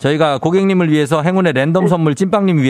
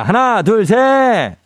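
countdown call; 8 semitones higher, much slower than usual. Real, not acted. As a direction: build the count up to the cheer. Host counting down the cheer. (힘차게 외치는 카운트다운)

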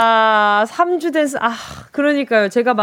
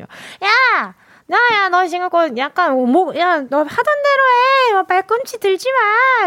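put-on much higher, drawn-out childish whine of protest; mock a kid crying foul, voice pitched way up; squabbling kid; 8 semitones higher, slower than usual. Radio host acting out a childhood height-measuring row, indignant kid voice. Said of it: "이러면서".

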